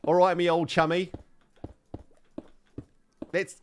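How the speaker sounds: loud, high mock Kent accent; hammed-up Kent geezer. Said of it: "Oh, Kent".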